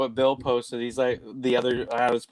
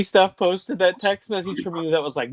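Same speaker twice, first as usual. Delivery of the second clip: normal talking voice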